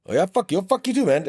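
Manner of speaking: high-pitched retort in a retold squabble; mock heated argument. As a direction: fire it back like a hotheaded young cop. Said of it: "going back and forth".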